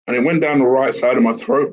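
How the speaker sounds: ordinary talk, nothing marked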